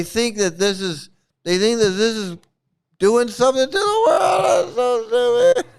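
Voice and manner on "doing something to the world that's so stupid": so fed up it turns into a squealing laugh; laughing exasperated shout. Real, not acted. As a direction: shout it high, cracking into laughter. Exasperated rant breaking into laughter.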